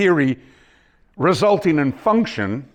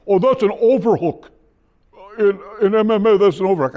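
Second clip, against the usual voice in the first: high, louder voice quoting a student; quoted student; 8 semitones higher, 4 dB louder than usual. Retold or imitated. Martial arts student correcting the teacher, higher and louder. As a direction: lightly voice the eager student.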